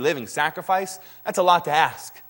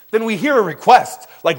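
ordinary delivery

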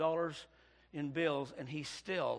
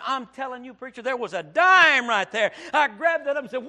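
loud, high, triumphant preaching; shouting preacher; 8 semitones higher, 17 dB louder than usual. Acted. Preacher telling the church he found the dime, shouting. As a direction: shout the testimony out.

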